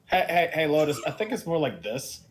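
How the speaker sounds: usual speaking voice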